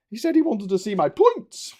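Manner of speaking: high, loud grotesque character; comic character voice. Acted. Sketch character reporting on a stranger, high comic voice. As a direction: complain loudly in a high put-on voice.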